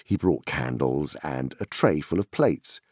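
ordinary delivery

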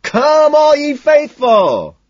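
high, full-voiced carol yell. A narrator's reading. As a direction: belt it out, drawn-out and very loud. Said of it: "I left the store yelling".

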